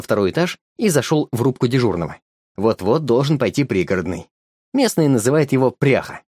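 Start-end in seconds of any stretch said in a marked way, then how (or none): none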